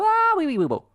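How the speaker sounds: normal talking voice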